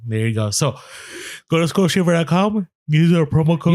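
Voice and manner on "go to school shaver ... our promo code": higher voice, talking through a yawn; a real yawn stretching the words. Real, not acted. Podcast host reading the sponsor's promo code, yawning. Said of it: "You sound like you were yawning mid".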